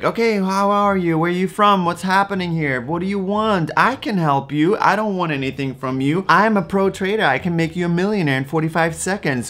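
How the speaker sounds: high-pitched, over-friendly sales patter; mock a scammer buttering up a victim; hammed-up eager pro trader, pitched high; super-nice scammer. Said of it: "trying to be super nice".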